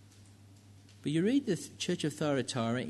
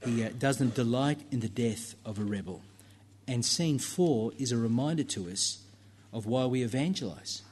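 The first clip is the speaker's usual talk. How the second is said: ordinary delivery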